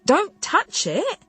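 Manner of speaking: high, slow drawn-out cry of protest; sharp protest. Acted. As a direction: snap it out to stop his hand. Man touches her hair.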